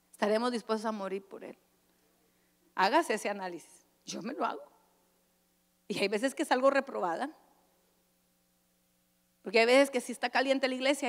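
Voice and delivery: ordinary talk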